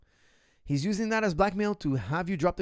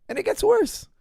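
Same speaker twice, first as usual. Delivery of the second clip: excited build; 7 dB louder than usual. Real, not acted. Teasing a worse twist.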